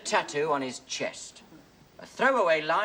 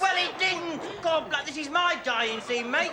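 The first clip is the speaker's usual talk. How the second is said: exasperated shout; 10 semitones higher than usual. Acted. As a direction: shout him down, fed up. Dying man snaps at scene-stealer.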